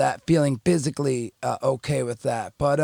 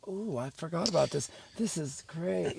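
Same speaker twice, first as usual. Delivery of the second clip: blissed-out murmur; 2 semitones higher, 9 dB quieter than usual. Retold or imitated. Drug high rush returning.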